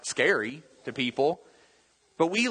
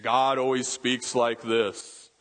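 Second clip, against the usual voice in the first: booming God voice; slower than usual. Retold or imitated. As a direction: do a slow, booming voice of God from the movies. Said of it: "He always has this booming voice".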